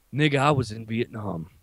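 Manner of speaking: put-on low, understated old-timer voice; Vietnam veteran. Veteran turning to the customer behind him, low rattled confession. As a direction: confide it low, phrase by phrase. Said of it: "turns around to me and goes".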